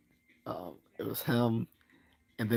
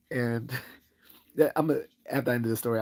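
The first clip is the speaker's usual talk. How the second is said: ordinary delivery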